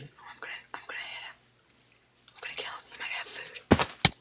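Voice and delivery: hushed plotting under the breath; very soft, secretive whisper; stealthy whisper. Real, not acted. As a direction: whisper it as if creeping up on someone. Sneaking up on another player.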